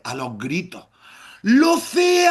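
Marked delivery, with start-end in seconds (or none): 1.4-2.3 high, loud calling shout; echoing shout of the name. Retold or imitated. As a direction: call her name at full voice. Repeats mother's shout.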